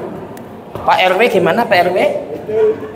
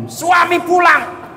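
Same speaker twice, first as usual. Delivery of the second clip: usual speaking voice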